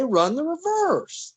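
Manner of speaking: high, slow, exasperated run the reverse; exasperated order. Retold or imitated. Bryant repeating his order to Mal, high and exasperated. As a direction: pitch it way up as the order is ignored again.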